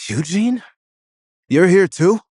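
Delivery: high, loud surprised call; shocked. A narrator's reading. Andrew spotting Eugene at the intervention, loud high surprise. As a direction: gasp it out in disbelief.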